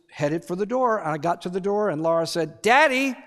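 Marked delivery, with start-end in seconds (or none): 2.6-3.2 lightly voiced child calling out; little girl calling. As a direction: call out like a small girl. Daughter calling from bed.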